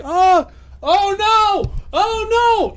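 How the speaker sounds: high, drawn-out comic wail; acted panic. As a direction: wail like someone about to be trampled. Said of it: "and he's like".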